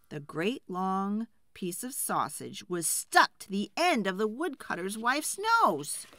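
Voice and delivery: ordinary delivery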